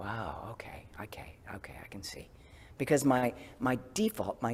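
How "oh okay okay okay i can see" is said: soft whispered okays; whispered reassurance. Retold or imitated. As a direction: hush yourself calmly. Gentle acknowledgment of own reaction.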